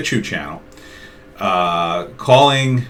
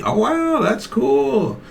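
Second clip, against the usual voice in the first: much higher fake wow; high, put-on gasp of interest; mock amazement; 12 semitones higher, slower than usual. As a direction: act impressed to draw him out. Feigned amazement at job.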